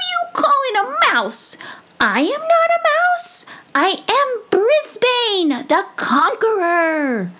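very high, huffy squeak, slowing on the title; tiny mouse puffing up with pride; indignant mouse. Acted. Mouse correcting the old woman, high and indignant. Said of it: "mouse insulted, proclaims himself".

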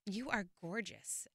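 low, soft and slow; soft flirty compliment. Retold or imitated. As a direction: breathe it like a charmer.

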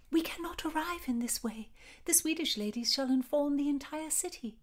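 put-on high, airy young woman's voice; giddy heroine. A narrator's reading. Heroine speaks into her lover's neck, high and giddy. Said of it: "giddy with unnamed expectation".

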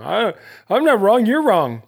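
loud, high-pitched mock comeback; indignant retort. Host play-arguing with whoever says he's wrong, indignant retort. Mock argument with absent accuser.

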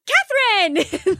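high, drawn-out excited name call; imitated delighted greeting. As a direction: mimic a child thrilled to spot a cousin. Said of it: "Penny's like ... throws her arms around her".

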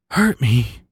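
understated, intimate plea; pleading. A narrator's reading. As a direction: beg without raising the voice. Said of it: "the raccoon pleaded".

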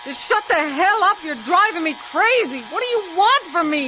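loud, high desperate yelling; furious yelling. Acted. Woman yelling at the voice driving her crazy, desperate. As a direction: yell at the voice tormenting her. Screaming at the voice.

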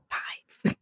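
breathy whispered "Five.". Retold or imitated. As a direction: barely voice it, like a shy correction. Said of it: "an English person came in with, holding up five fingers and going".

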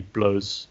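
one-syllable Blows ending in a z sound. Shown as a demonstration. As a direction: say Blows like the English word, silent E. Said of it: "It might just be Blows".

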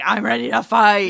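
loud mock battle cry; fired-up for a fight. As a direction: shout it like you're squaring up. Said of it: "and you're like".

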